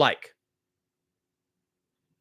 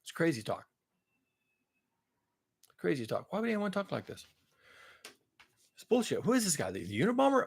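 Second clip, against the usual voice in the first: much softer, muttered mockery of a skeptic; scoffing critic; 9 dB quieter than usual. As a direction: mutter it like someone dismissing crazy ideas. Voicing imagined scoffing critic.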